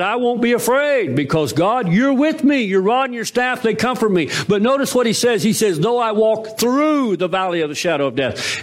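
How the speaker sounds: high fervent psalm quote; preaching. Acted. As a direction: lift it up like a proclamation.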